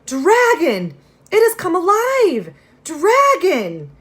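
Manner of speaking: loud, high panicked scream; a servant screaming in terror. Acted. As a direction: shriek the alarm. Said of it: "the servant screaming".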